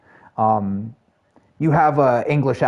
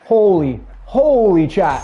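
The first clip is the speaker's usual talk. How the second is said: loud, high burst of real astonishment; stunned gamer outburst, pitched up; amazed exclamation; 7 semitones higher, 6 dB louder, slower than usual. Real, not acted. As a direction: exclaim to chat in excited disbelief. Amazed at own shot in game.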